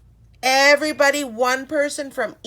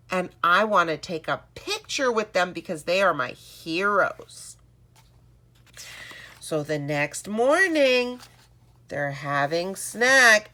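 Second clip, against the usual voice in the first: usual speaking voice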